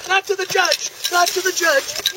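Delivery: firm loud command to a dog. Real, not acted. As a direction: call the dog off sharply. Urging dog away from judge.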